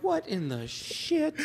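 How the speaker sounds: slow, quiet, high stunned disbelief. Retold or imitated. Husband reacting to his wife's remark, slow stunned disbelief.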